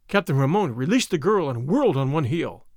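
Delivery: usual speaking voice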